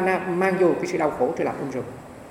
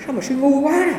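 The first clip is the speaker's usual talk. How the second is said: much higher, a startled mock cry on quá; startled exclamation; 11 semitones higher, slower than usual. Master startling the hot-tempered monk, mock startled cry. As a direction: jolt the words out like a sudden shock. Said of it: "Ông giật mình cái âm liền".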